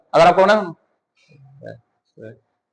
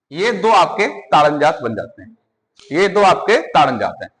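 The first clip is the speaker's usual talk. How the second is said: ordinary talk, nothing marked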